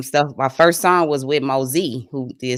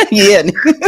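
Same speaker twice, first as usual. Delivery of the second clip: laughing echo; 7 semitones higher, 10 dB louder than usual. Retold or imitated. Friend echoes the punchline laughing, laughing. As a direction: repeat it through a high laugh.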